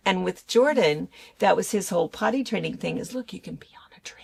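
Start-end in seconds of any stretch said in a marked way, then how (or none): 3.2-4.2 quick, near-whispered encouragement; hushed coaxing. Retold or imitated. Parent potty training a small boy, coaxing in a whisper. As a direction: share it like a little secret.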